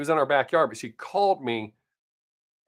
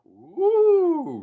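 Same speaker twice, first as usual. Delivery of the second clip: high, stretched woo; mock-thrilled woo about a rock; excited whoop; 14 semitones higher than usual. Real, not acted. Showing off a rock.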